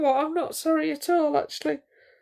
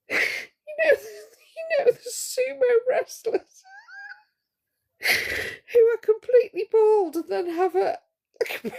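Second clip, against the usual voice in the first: high, tearful laughing through the words; barely getting it out for laughing; real helpless giggling; crying with laughter; 5 semitones higher than usual. Real, not acted. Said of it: "do you know why I'm laughing".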